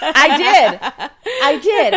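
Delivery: laughing loudly while admitting it; real laughing confession. Real, not acted. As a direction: blurt it out through laughter. Laughing admission of stripping.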